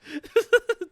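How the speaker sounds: high giggly laughter; laughing. Real, not acted. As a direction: laugh through it.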